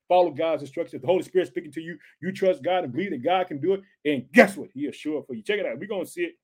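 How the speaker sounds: ordinary talk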